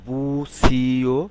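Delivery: বুসিও stretched very slowly, every sound long; drawn-out model word. Shown as a demonstration. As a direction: draw out বুসিও slowly so they catch it. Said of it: "তাহলে এটা কি হবে?".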